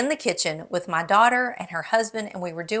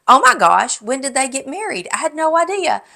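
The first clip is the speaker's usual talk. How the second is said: high, loud burst of mock shock; astonished viewer; 6 semitones higher, 8 dB louder than usual. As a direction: imitate a commenter stunned by big news. Acting out a surprised viewer's comment.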